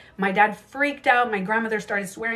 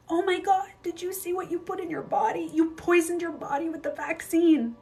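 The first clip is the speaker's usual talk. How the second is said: mock crying; 9 semitones higher than usual. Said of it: "This person was crying".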